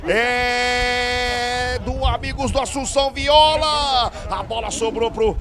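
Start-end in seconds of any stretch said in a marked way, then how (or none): 0.1-4.1 fast build to a roaring goal call; explosive goal commentary. Real, not acted. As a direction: shout the goal, stretch it out. Segundo gol narrado ao vivo. (grito de gol explosivo)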